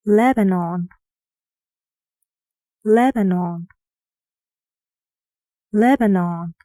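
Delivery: "Lebanon" with a loud "Leb", lighter ending; stressed first syllable. Shown as a demonstration. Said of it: "guess which syllable is stressed".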